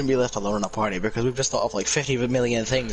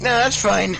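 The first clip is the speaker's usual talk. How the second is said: higher, louder dismissive tsundere; tsundere act; 7 semitones higher, 4 dB louder, slower than usual. Acted. Tsundere character denying a crush, higher and dismissive. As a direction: brush it off like a tsundere, pitched up. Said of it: "that classic fucking tsundere act".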